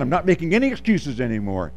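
ordinary talk

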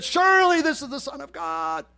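very high, ringing exclamation; awed exclamation. Acted. Centurion becoming a believer on the spot, high outcry. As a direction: cry it out high as a stunned convert.

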